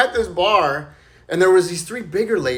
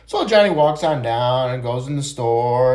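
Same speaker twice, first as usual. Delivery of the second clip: ordinary delivery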